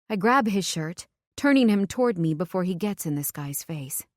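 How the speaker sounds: ordinary delivery, nothing marked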